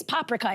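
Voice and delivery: "paprika" as PAH-pri-ka; first-syllable stress. Retold or imitated. Host quoting a Hungarian woman's correction, first-syllable stress on "paprika". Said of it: "the emphasis is on the first syllable".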